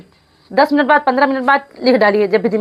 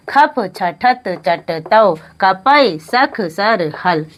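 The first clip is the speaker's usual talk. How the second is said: slow recitation; 3 semitones lower, much slower than usual. Shown as a demonstration. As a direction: recite each sutra slowly, one by one. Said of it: "हमारे साथ साथ बोलते चलिए आप लोग".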